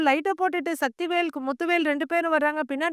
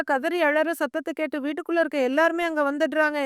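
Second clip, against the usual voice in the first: ordinary delivery, nothing marked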